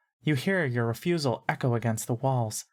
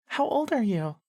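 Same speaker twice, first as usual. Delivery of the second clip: put-on much higher little-girl voice; high-pitched girl; 10 semitones higher than usual. A narrator's reading. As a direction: ask it in a high, girlish pitch. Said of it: "and high-pitched".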